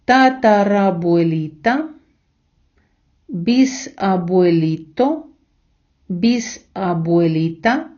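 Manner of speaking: slowly, syllable by syllable. Shown as a demonstration. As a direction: spell each word out slowly, syllable by syllable. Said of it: "спочатку повільно по складах". (повільно, по складах)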